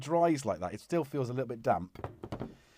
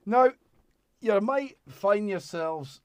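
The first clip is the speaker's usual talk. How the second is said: slow, higher Scottish lilt; a sudden Scottish voice; Scottish accent; 7 semitones higher, 6 dB louder, slower than usual. Real, not acted. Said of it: "I've gone Scottish".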